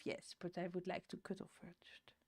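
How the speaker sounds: soft whispered aside. Real, not acted. Owner whispers about the pet wanting a cuddle. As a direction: breathe it out, no voice.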